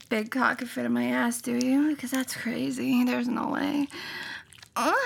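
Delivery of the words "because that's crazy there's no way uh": ordinary talk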